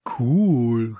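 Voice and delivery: loud, long "cool"; excited shout. A narrator's reading. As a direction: call it out in admiration. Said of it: "rief Samson". (begeistert und laut gerufen)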